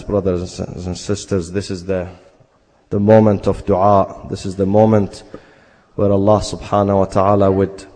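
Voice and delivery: low, fast murmured prayer; rapid supplication. Acted. Preacher leads the congregation in dua, in rapid low prayer. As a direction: rush through the dua in a hushed voice.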